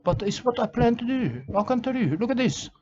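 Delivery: ordinary talk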